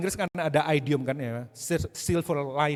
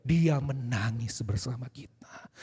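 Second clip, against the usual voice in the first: hushed whisper; 4 semitones lower, slower than usual. Acted. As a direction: whisper it slowly, full of tenderness. Preacher describing Jesus weeping.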